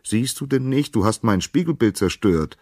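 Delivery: loud, high-pitched reproach; indignant call. A narrator's reading. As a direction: scold her crossly. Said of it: "rief Narkissos".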